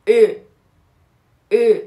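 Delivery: ا as Turkish ö, rounded and fronted; isolated ا said as "ö". Shown as a demonstration. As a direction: sound out ا like "o" pushed thicker and upward.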